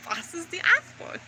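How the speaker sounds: soft but jumping high, rising at the end; imitating unhinged questioning. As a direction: squeak upward like someone cracking up. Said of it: "I was fucking losing my mind".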